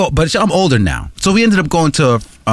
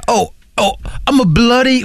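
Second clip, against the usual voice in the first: put-on British accent; 7 semitones higher than usual. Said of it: "Australian accents".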